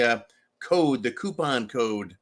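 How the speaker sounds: usual speaking voice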